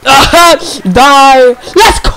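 shouted triumphant cry; battle cry. Real, not acted. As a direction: yell in triumph. Kills enemy.

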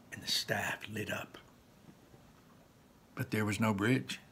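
hushed whisper of wonder; whispered narration. A narrator's reading. Narrator whispering as the staff lights up. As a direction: breathe it like a secret.

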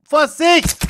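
high, loud warning; warning shout. Real, not acted. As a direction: call out a sudden warning. Warns during the game.

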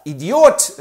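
loud, high insult; angry insult. Acted. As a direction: spit out the insult. Ganja beschimpft den Fürsten.

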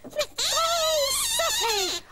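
very high, drawn wail of exasperation; upset wail. Acted. Teased girl crying out to Sooty, upset wail. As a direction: wail the name high in protest.

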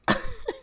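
squealing high laugh; high-pitched giggle. Real, not acted. Laugh after college funds.

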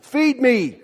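high, demanding monster-plant cry; hungry plant. Acted. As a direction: demand food like a ravenous plant. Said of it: "the big plant that's like that".

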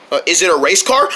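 ordinary delivery

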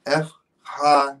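F, ha with a breathy Greek ch on ha; F, then ha, each syllable separate; broken-down syllables. Shown as a demonstration. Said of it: "break it down for me".

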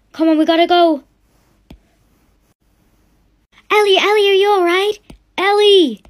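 urgent shouting. Acted. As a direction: round everyone up in a panic. Family rushing to daughter in labor.

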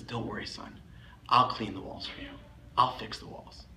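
very soft, hushed fatherly comfort; low, gentle promise with pauses between phrases; tender reassurance. Retold or imitated. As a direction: soothe the child quietly, nearly whispering. Father reassures crying son softly.

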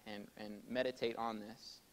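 ordinary delivery

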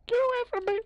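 very high, piercing scream; shrill panicked shout. Real, not acted. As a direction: scream him away in terror. Fleeing Slender in panic.

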